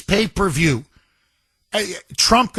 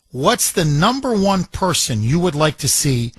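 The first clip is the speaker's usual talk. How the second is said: ordinary talk, nothing marked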